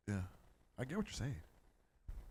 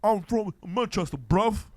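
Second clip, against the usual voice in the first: loud, high-pitched Manchester taunt; mock Manchester accent; 8 semitones higher, 11 dB louder, slower than usual. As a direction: bellow it like a mock Mancunian tough. Mocking a British trash-talker from the clip.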